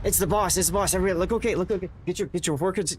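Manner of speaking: hammed-up alarm at the boss arriving; mock panic. Streamer tells everybody the boss is here, mock panicked. Pretends staff must impress the boss.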